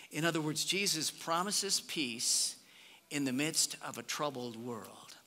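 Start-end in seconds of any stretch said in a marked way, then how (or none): none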